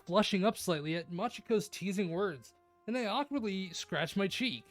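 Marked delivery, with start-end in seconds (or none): none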